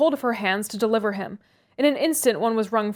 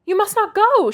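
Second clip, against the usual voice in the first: much higher, loud command; energetic exclamation; 11 semitones higher, 6 dB louder than usual. A narrator's reading. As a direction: forbid him to leave. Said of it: "she exclaimed energetically".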